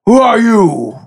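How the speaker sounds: loud, high, far-off guard voice; guard challenge. Acted. GM voicing a suspicious guard, loud challenge. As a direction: bark the question at strangers.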